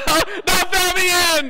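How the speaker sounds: high, loud, slow begging; comic terrified plea not to be burned; mock panicked plea. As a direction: plead for your life, hammed-up. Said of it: "You know".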